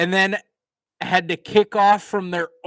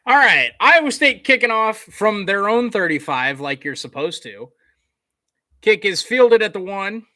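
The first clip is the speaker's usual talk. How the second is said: brisk, punchy sportscaster call, louder on the big moments; live play-by-play; 5 semitones higher, 6 dB louder than usual. Acted. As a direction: call the return like a TV announcer. Calls the game play-by-play.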